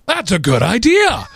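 exaggerated running-joke astonishment; high, stretched-out mock amazement; surprised. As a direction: praise it as if utterly stunned. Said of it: "sort of a running joke".

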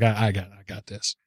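lightly mocking a cocky coworker; cocky Derek. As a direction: toss it off low and quiet like it's nothing. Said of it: "He's like".

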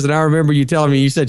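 usual speaking voice

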